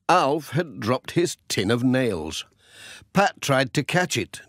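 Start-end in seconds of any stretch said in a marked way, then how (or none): none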